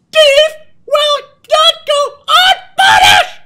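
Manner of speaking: very high, slow shouted decree; booming proclamation. Pretend. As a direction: shout it out word by word like a verdict. Booming threat in mock proclamation.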